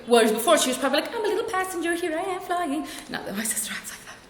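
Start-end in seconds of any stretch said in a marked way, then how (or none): 1.1-2.9 high-pitched, childlike sing-along mock voice; carefree little passenger. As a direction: play an innocent little traveller, high and soft. Sister's former carefree self, mocked.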